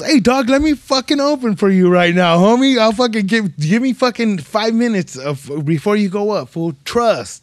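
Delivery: loud, high, pumped-up hustle; hyped pitch. Retold or imitated. Comic begging headliners for an opening spot, loud and hyped. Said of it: "I'll tell fools".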